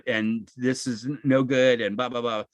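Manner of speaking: usual speaking voice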